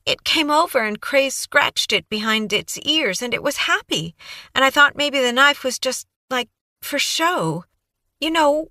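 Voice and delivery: put-on high, breathless young woman babbling on; Kaylee's eager voice. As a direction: gush it out like a nervous, eager witness. Kaylee recounting the cruelty.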